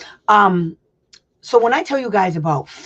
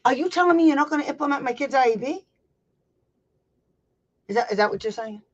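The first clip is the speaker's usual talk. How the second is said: high, fast, challenging question; confrontational demo; 8 semitones higher, faster than usual. Shown as a demonstration. Showing parents how to confront a teacher, sharply challenging. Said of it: "You do just like this".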